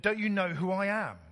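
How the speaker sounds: a light hint of a pompous star, no accent; entitled demand. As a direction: play the celebrity who expects to be let in. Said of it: "I won't do the Kiwi accent".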